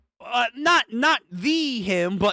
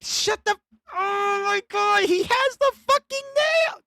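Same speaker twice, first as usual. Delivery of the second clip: much higher, stunned outburst; shocked squeal; 13 semitones higher than usual. Real, not acted. Fan to stream, shocked high squeal over the nails. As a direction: shriek it in disbelief.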